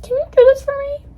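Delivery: very high, loud pleading voice; hammed-up helpless asking; mock helpless plea. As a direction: put on a squeaky little request for help. Acts out asking for help.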